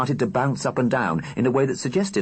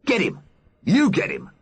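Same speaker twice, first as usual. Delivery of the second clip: fight shout; 12 semitones higher than usual. Acted.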